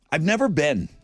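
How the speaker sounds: usual speaking voice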